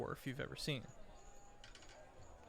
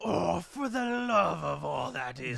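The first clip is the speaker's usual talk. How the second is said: exasperated shout; 3 semitones higher, 11 dB louder than usual. Acted. Dwarf lord crying out at the arriving ship, exasperated shout. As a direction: shout in disbelief at the sky.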